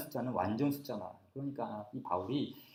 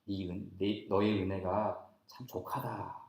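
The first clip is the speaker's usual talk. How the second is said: slow, low and soft; soft, gentle wording; 7 semitones lower, 8 dB quieter, slower than usual. Acted. God's refusal as the Bible softly puts it, gentle. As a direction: say it mildly, taking your time. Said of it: "부드럽게 표현한 거예요".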